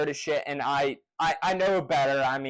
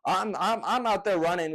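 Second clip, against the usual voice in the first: usual speaking voice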